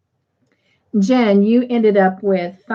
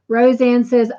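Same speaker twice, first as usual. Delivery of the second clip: usual speaking voice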